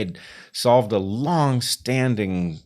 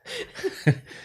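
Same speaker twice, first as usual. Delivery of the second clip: high giggle. Real, not acted. S0 breaks into giggles.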